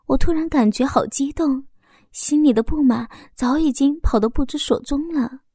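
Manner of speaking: ordinary delivery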